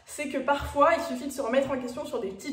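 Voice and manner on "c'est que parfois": ordinary delivery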